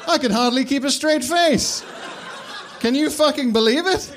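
can barely speak for laughing; hysterical laughter. Acted. Headmaster mocking the squib's fate, in hysterics. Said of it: "He was in hysterics now".

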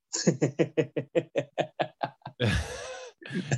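imitated high nervous tittering; terrified mum's laugh. Mum dreading what her son will say, imitated nervous laugh. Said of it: "my mum is consistently like this".

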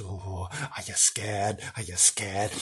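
whisper-tease the listener; teasing hush. Acted. Host teasing listeners about the huge dog, hushed.

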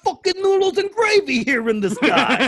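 high Italian-American wiseguy grumble; hammy Italian-American voice through laughter; mock Italian-American. Continues the Italian stereotype bit.

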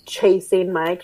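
usual speaking voice

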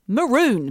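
MAROON drawn out on the oo; MAROON ending like "balloon"; correct MAROON. Shown as a demonstration. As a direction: say MAROON with a long oo, rhyming with moon. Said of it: "but it's maroon".

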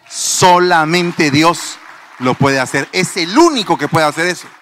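loud, forceful preaching declaration; emphatic proclamation. Real, not acted. Preacher proclaiming to the congregation only God can, loud declaration. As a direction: proclaim it with full conviction.

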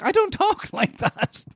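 very high, slow, laughing protest; mock protest. Cork speaker objects to northerners' imitation, high mock protest. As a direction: squeal it in comic indignation. Acted protest at being mimicked.

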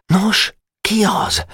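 high, slow worried voice; eager boy afraid of the answer; urgent boyish asking; anxious question. Acted. Boys press Boka anxiously.

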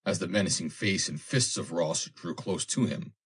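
ordinary delivery, nothing marked

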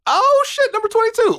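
high-pitched burst of surprise; excited surprise. Real, not acted. Host reacting to the last topic coming up, high excited surprise. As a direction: blurt it out high, thrilled by the roll.

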